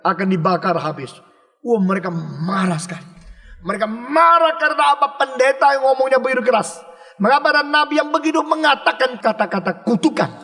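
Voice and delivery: ordinary talk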